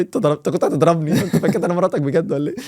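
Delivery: lightly voiced indignant wife; actress playing the wife. Actress pushing back on the father in radio drama, indignant. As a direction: push back, offended. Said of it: "حتى الست بتقول لي".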